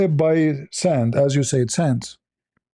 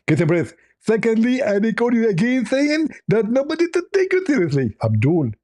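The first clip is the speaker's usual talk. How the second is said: much higher, squeezed mocking preacher voice; Zakir Naik impression; 7 semitones higher than usual. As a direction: squeeze it high and smug. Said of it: "I am Doctor Zakir Naik".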